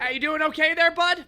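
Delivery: high, loud, chirping Canadian greeting; Canadian accent. Shown as a demonstration. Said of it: "let me give you some Canadian translations".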